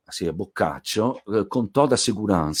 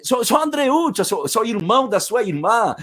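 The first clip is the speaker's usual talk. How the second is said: pleading call; 12 semitones higher than usual. Retold or imitated. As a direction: beg to be let in, explaining in a rush. Andreuccio insisting at door.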